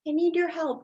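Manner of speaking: usual speaking voice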